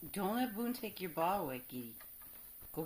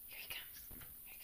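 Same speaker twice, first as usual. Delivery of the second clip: suspenseful whisper; 12 dB quieter than usual. Real, not acted. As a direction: warn in a hush. Cat approaching, hushed warning.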